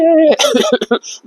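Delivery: high, choking cough mid-sentence; strained cough. Acted. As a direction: cough as if the words hurt. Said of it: "এমনিতে আপনি কথা বলতে পারছেন না". (কষ্টের কাশি)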